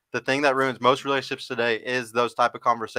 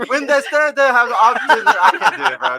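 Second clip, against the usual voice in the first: attempted Jamaican accent, higher and louder; slow, loud try at a Jamaican accent; 9 semitones higher, 10 dB louder than usual. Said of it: "Read it in the Jamaican voice".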